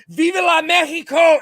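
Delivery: very high, loud holler; imitated war cry. Imitating Ricky Johnson in eighth grade, hollering. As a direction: shout it out as a mock rallying cry.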